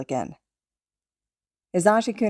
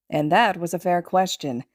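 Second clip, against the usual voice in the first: ordinary delivery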